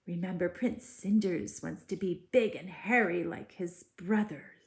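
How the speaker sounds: ordinary delivery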